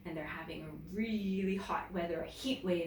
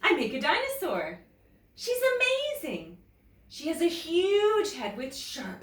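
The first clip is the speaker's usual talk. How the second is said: higher and louder, thrilled boast; proud excitement; 8 semitones higher, 4 dB louder than usual. A narrator's reading. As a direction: show off your dinosaur. Emily boasting about her dinosaur.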